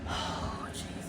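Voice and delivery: long sigh into hushed, unvoiced words; hushed, overcome exhale; overwhelmed sigh. Real, not acted. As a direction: sigh it out softly, almost whispered. Overcome reaction to the book scene.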